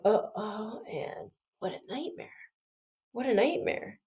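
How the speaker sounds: retold dread, said under the voice; quiet dismay. Inward dismay at classmates' marks.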